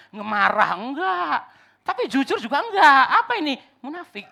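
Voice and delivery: high, loud rhetorical build; loud pulpit exasperation; exasperated preaching. Real, not acted. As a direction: cry it out, throwing each denial high.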